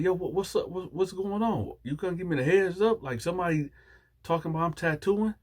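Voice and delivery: high-pitched, puzzled protest; mock-bewildered questioning; baffled questioning. Acted confused questioning of her.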